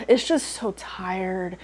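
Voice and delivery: slow, low, drained; weary exasperation. Real, not acted. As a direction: drag it out, worn out by the arguing. Weary sigh at repeated arguments.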